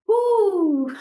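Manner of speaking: sudden high, loud woo; a startled, delighted whoop; whooping cry. Real, not acted. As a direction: let it burst out high and loud. Hit by the light blast.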